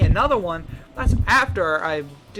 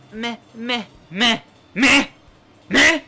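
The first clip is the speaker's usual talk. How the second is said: rising mocking bleats; mocking meh; 5 semitones higher, 5 dB louder, slower than usual. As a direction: taunt with meh. Mocking imitation noises.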